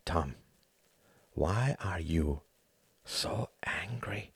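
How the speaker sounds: slow, soft, ending in a whisper; a friend quietly probing Tom's anger, fading to a whisper; hushed concern. Acted. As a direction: ask it gently, almost breathless. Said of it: "Something in Hart's tone stopped".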